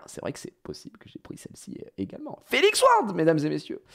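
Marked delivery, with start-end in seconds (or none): 2.0-3.8 showman announcement. Acted. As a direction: present it to the crowd like a host. (annonce de présentateur, très aiguë et forte)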